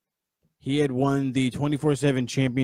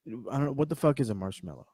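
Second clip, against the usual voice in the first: ordinary delivery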